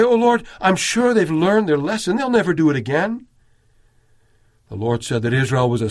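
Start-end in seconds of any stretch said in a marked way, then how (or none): 0.0-3.3 higher, eager voice promising they've learned; mock naive assurance. Moses as he did not pray, a higher eager mock assurance. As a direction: gush it hopefully, like a naive excuse. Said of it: "He didn't say".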